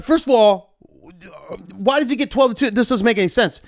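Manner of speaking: high, indignant protest. Retold or imitated. DJ arguing with the promoter, high and indignant. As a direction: argue it, pitched high.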